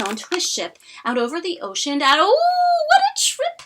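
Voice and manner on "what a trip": ordinary delivery, nothing marked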